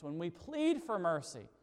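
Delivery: ordinary talk, nothing marked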